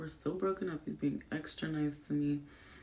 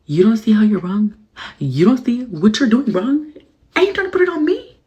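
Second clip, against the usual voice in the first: louder, higher indignant rant; outraged yell; 7 semitones higher, 19 dB louder than usual. Real, not acted. Telling viewers about his hypocrisy, outraged yell. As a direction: shout your disbelief at him.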